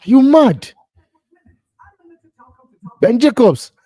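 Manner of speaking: loud, high scolding of the reporter; scoffing outburst. Real, not acted. Scolding the journalist for the rumour, loud. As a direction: call him out in disbelief.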